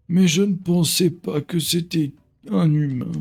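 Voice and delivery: put-on slow, high old elder; old man voice. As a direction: read it like an ancient wise elder. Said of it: "J'essaie de faire une voix de vieux".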